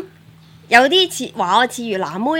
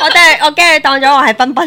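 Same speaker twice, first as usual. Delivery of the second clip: laughing; 6 semitones higher, 9 dB louder, faster than usual. Real, not acted. Jokes about being mistaken for a maid.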